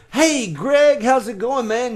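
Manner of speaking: high, loud friendly hail; eager greeting. Retold or imitated. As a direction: call out to an old friend across the room. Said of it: "walk up to somebody and go".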